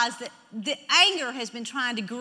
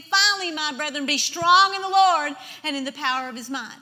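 loud, charged exhortation; emphatic scripture proclamation; 7 dB louder than usual. Acted. Preacher quoting Ephesians to the congregation, emphatic. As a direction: urge them with the verse.

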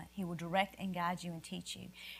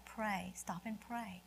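much softer, gentle prompting; quiet inner voice; 9 dB quieter, slower than usual. Retold or imitated. Preacher voicing God's inner call to pray. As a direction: murmur it as a still small voice. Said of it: "you're hearing this voice inside".

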